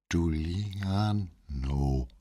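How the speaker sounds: very slow, dragged-out pained name; pained drawl. A narrator's reading. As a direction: ache through each syllable. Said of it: "so schmerzlich".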